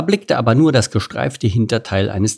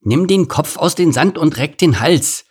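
raised, high instruction; called-out advice; 6 semitones higher than usual. A narrator's reading. Meerkat advising ostrich to look up, calling. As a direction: call it over with some impatience.

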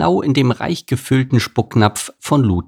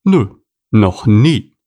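louder scornful no; huffy horse; 5 dB louder, slower than usual. Acted. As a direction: scoff at the idea. Anton answers Gerhard.

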